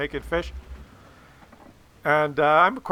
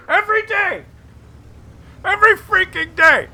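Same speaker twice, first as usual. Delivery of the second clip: much higher, louder outburst of disbelief; exasperated shout; 7 dB louder than usual. Real, not acted. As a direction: shout it like you can't take more fish. Exasperated about daily fish.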